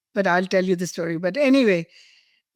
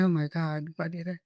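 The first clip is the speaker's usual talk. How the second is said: soft, dropping "oh my God" to herself; quietly horrified at her own words; dismayed murmur; 3 semitones lower, 9 dB quieter than usual. Retold or imitated. Panicking alone in bathroom.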